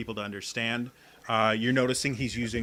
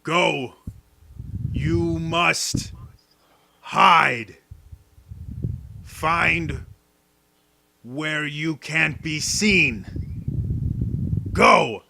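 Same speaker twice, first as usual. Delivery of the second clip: loud, higher orders, with the middle slowed; commanding the crowd; 5 semitones higher, 7 dB louder, much slower than usual. Acted. Creature leader ordering the crowd to hide, loud and urgent. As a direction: urge them to scatter and hide. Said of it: "addresses the crowd".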